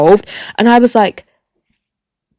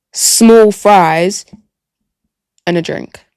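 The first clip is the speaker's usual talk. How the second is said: ordinary delivery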